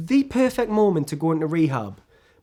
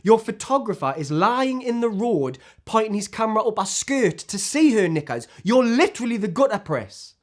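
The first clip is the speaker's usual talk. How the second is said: higher-pitched, outraged accusation; indignant rant; 4 semitones higher than usual. Acted. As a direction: hammer the accusation home. Ranting accusation at the tabloid press.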